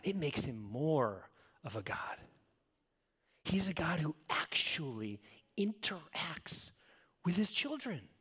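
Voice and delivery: whispered, tender conviction; hushed whisper. Real, not acted. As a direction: drop to a breathy whisper to land it. Intimate point about God.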